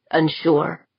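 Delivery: plain reading voice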